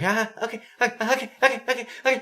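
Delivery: high-pitched mock kid, okay after okay; squeaky, high kid impression; imitating clueless kid. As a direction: play an over-eager little kid who isn't taking it in. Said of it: "he looks at you like".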